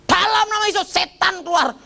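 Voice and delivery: imitating a preacher casting out a demon, pitched high; high ringing deliverance shout; hammed-up preacher rebuking a demon; mock exorcism cry.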